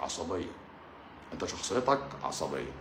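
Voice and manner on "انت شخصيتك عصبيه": much lower, softer mock doctor; a lower, quieter doctor parody. Shown as a demonstration. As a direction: put on a patronizing doctor's voice. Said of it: "repeated in a put-on voice".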